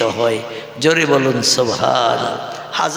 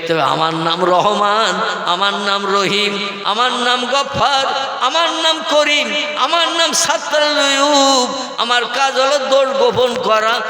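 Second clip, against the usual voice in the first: rising proclamation; 7 semitones higher than usual. Acted. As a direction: build each name higher. Divine names, rising chant. (প্রতিটি নামে গলা চড়িয়ে ঘোষণা)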